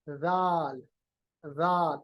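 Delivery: رال said with the tongue tip soft against the upper teeth; a soft, gentle رال demonstrated. Shown as a demonstration. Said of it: "آواز خود بخود نرم ہو جاتی ہے". (رال کو نرم آواز سے ادا کریں)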